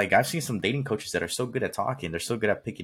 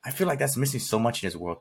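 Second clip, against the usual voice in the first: ordinary delivery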